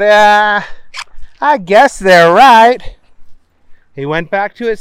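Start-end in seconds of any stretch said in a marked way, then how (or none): 0.0-3.0 slow, loud resigned sigh. Acted. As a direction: give in slowly. Booger thinks aloud.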